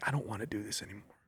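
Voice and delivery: quiet, broken plea; a hushed breaking confession. Retold or imitated. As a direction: barely get it out, near tears. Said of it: "I just broke down".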